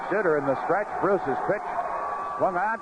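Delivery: ordinary delivery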